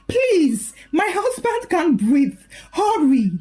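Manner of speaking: high, urgent, pleading; frantic call for an ambulance; panicked plea. Acted. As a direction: beg the dispatcher in panic.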